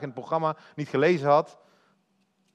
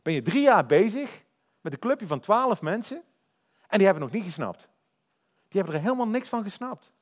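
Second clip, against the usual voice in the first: ordinary talk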